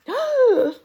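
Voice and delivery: big high gasp; storyteller gasping in mock shock; surprised gasp. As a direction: gasp for the children. Reading to kids, surprise.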